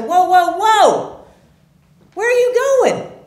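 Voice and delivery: high, loud cry to halt someone; alarmed protest. Retold or imitated. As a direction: call out urgently to stop him leaving. Retold confrontation stopping father leaving.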